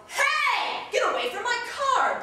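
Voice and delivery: high, hard-projected shout; big ringing warning yell; loud diaphragm yell. Shown as a demonstration. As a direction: belt it from the diaphragm at a car thief. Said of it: "try to get really loud from the diaphragm and yell".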